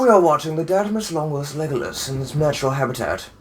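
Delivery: hushed wildlife narrator voice; nature documentary. Pretend.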